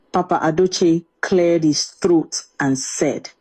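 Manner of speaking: plain reading voice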